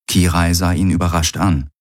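ordinary delivery, nothing marked

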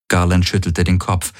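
usual speaking voice

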